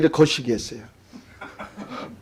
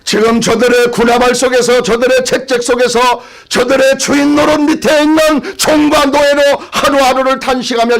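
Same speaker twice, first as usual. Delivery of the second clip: high-pitched, pressing proclamation; fervent preaching; 7 semitones higher than usual. Real, not acted. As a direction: proclaim it to the congregation in a raised pitch.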